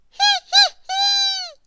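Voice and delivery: very high, loud tiny ha ha ha; squeaky mouse laugh. Acted. Squeak showing Grizzle how to laugh, high squeaky ha ha. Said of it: "replied Squeak".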